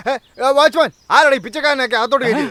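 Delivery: high-pitched scolding call to the watchman; indignant shout. Acted. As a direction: call out sharply, outraged at an intruder. Scolds watchman over supposed beggar. (ഉയർന്ന സ്വരത്തിൽ ദേഷ്യപ്പെട്ട് വിളിക്കുക)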